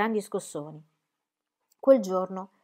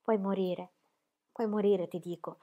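soft, shaken warning fading to nothing; frightened boy barely voicing it; trembling plea; 2 semitones lower, 7 dB quieter than usual. A narrator's reading. Shepherd boy warns pregnant woman she may die, soft and trembling. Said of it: "Unai tremava scotendo la testa".

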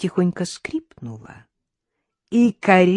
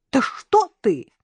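higher-pitched startled protest; alarmed objection; 8 semitones higher, slower than usual. A narrator's reading. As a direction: recoil at the idea, pitch up. Mother-in-law recoils at the idea. (испуганно возражая)

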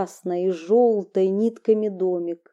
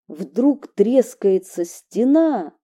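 usual speaking voice

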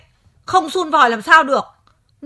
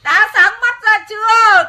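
loud, slow, raised reproach; scolding; 6 semitones higher, 6 dB louder, slower than usual. Acted. Wife scolds her husband for burning the straw, loud and slow. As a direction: rub his nose in it.

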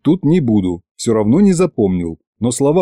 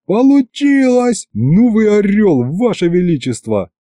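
elated, soaring congratulation; jubilant cheer; 9 semitones higher than usual. A narrator's reading. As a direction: exult and praise the ruler. Cheering the tsar's success.

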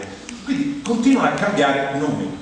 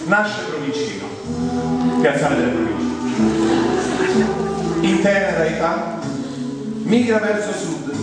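mock documentary narration; 3 semitones higher than usual. Comedian narrating his own origins as a wildlife documentary, mock-solemn. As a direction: announce it grandly, drawing out the phrases.

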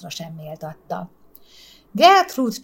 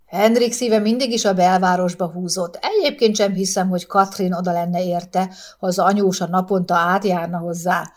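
usual speaking voice

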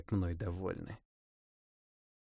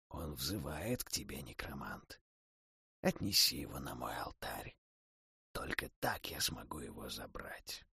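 low, calm, unvoiced whisper; Mor, whispering; 7 semitones lower, 9 dB quieter than usual. Death god quietly instructs the necromancer, low whisper. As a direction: breathe the words like a deathly god. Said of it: "совершенно спокойно произнёс бог смерти".

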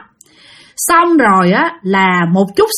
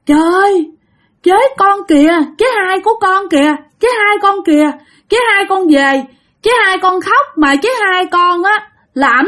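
high-pitched imitated child, crying out what he sees; little boy; 8 semitones higher than usual. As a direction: voice a scared small boy, pitched well up. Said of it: "thằng nhỏ nói vậy nè". (giọng bé trai hoảng hốt, cao vút)

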